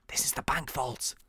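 hushed whisper; secretive whisper entering the vault. Real, not acted. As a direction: breathe it so nobody hears. Sneaking into the vault.

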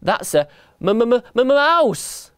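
high, drawn-out stutter into a wail; terrified stammer. A narrator's reading. Elephant dad spotting the thing he fears, terrified stammer. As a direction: stutter on the m, then cry it out. Mouse-fearing dad spots a mouse.

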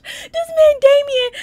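high, loud wail of disbelief; distraught disbelief. Real, not acted. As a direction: wail it, overwhelmed by what he did. Overwhelmed by the killings.

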